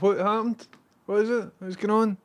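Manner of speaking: high, nosy sheep chatter; curious sheep voices. Retold or imitated. Voicing the curious sheep flock.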